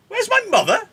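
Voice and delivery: very high, loud screech; outraged disbelief. Acted. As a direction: shriek the question in disbelief. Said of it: "Juliet can't believe that".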